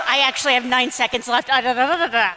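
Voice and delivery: ordinary talk, nothing marked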